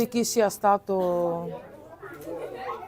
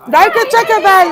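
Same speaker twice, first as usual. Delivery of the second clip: loud, high excited cheer; 10 semitones higher, 11 dB louder than usual. Real, not acted. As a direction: cheer the team on at full voice. Cheering a goal chance.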